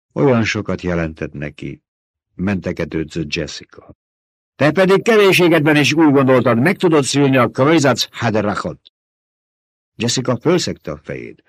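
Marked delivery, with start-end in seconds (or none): 4.6-8.8 put-on scolding old woman.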